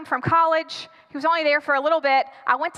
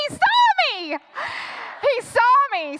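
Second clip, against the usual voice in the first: high, alarmed squeal of words; panicked outburst; 8 semitones higher, slower than usual. Acted. Girlfriend spotted through the window, panicking. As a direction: blurt it high in hammed-up horror.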